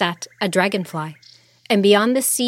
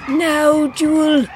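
usual speaking voice

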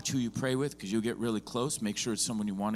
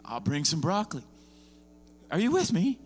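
high, loud, driving sermon delivery; fired-up pulpit preaching; preaching at full force; 7 semitones higher, 4 dB louder than usual. Real, not acted. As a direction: preach it hard to the room.

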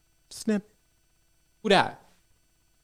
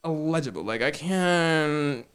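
pretending not to hear; 4 dB quieter, slower than usual. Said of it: "You gonna be talking about me like".

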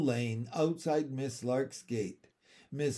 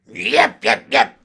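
lower, very loud barked yaps; dog yapping; 3 semitones lower, 15 dB louder than usual. A narrator's reading. Andrew refusing the coal cellar, dog yapping. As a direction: yap sharply like a demanding dog. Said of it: "Another yap from Andrew".

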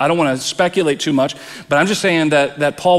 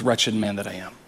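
much lower and softer, "Wretched man that I am" dropped to a near-murmur; quiet resignation; 6 semitones lower, 8 dB quieter than usual. Retold or imitated. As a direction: say it as someone stuck in defeat. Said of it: "you don't stop with".

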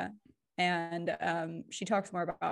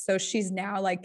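ordinary talk

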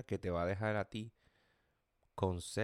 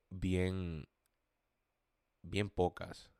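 ordinary talk

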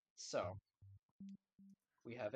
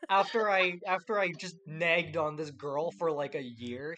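loud, raised-voice boast in jest; mock triumph; 3 semitones higher, 10 dB louder than usual. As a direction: crow it like a proud suitor. Sarcastically voicing the persistent suitor.

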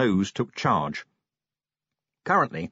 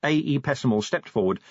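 usual speaking voice

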